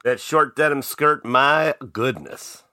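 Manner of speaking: ordinary delivery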